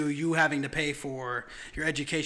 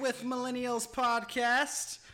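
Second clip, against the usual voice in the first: podcast host intro; 6 semitones higher, 3 dB quieter, slower than usual. Acted.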